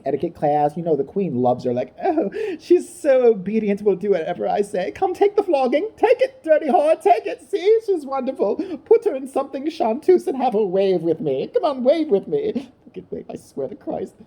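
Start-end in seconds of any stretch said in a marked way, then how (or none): 2.0-12.7 high, hammed-up posh queen; mock queen. Comic mocks the queen praising Kate. 12.9-14.2 mock aside. Queen hisses aside.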